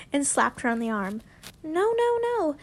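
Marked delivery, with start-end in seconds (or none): 1.6-2.6 protesting. A narrator's reading. Draco protests to his friends.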